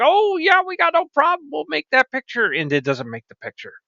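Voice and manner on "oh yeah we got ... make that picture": high, sing-song eager agreement; eager Copilot. Retold or imitated. Copilot promising to make the picture, eager. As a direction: put on an over-willing assistant. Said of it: "it's saying, like".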